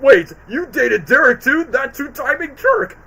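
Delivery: high, slow, loud mock indignation; dim-witted Bob. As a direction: play a clueless blob shocked at betrayal. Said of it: "he's so stupid where he's like".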